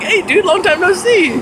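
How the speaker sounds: excited greeting. Retold or imitated. Greeting Connor again after a while, high and delighted. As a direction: sing out the hello to an old friend.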